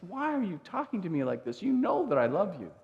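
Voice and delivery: lightly voiced annoyed Peter. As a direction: push back, a touch irritated. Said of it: "Peter's kind of annoyed".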